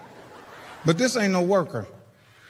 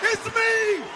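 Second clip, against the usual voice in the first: relieved high excitement; slower than usual. Retold or imitated.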